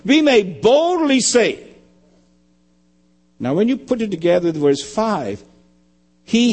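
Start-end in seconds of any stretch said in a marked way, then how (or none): 0.1-1.6 "We may boldly say" slow, high and emphatic; each word of "We may boldly say" set apart; stress on boldly. Real, not acted. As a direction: lean hard into "boldly say". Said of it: "So that we may boldly say".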